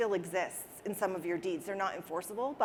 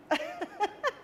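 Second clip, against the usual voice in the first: high little laugh through tears; embarrassed teary giggle; tearful laugh. Real, not acted. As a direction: let a teary laugh slip out.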